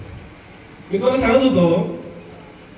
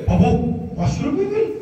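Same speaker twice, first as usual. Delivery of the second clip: shocked at bill; 4 semitones higher than usual. Retold or imitated. Thrifty father hears juice bill from son, high mock shock. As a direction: pitch leaping up into a question.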